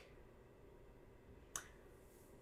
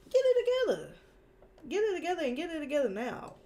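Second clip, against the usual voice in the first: exasperated scolding; 7 semitones higher, 4 dB louder, faster than usual. Real, not acted. Scolding the Chicago team.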